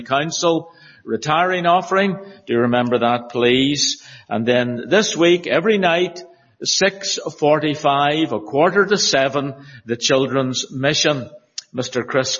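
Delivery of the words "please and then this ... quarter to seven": ordinary talk, nothing marked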